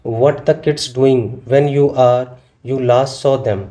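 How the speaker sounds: English sentence read out slowly; slow, careful classroom reading; slow dictation read. Shown as a demonstration. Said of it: "जैसे first है".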